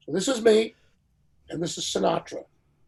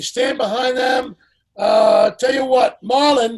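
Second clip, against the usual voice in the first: calling out names, higher and louder; 7 semitones higher, 10 dB louder than usual. Retold or imitated. [name] lining up stars on the dais, a loud commanding call. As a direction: summon the stars like the host in charge. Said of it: "So he says".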